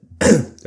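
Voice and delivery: sharp, loud throat clear. Real, not acted. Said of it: "oh, I'm stuttering".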